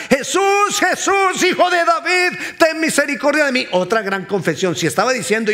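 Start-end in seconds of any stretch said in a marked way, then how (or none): 0.1-3.6 high, full-voiced cry of a blind beggar; loud, high-pitched pleading shout. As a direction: shout out desperately for mercy. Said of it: "empieza a gritar".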